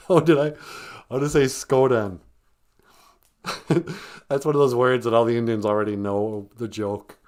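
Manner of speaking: ordinary delivery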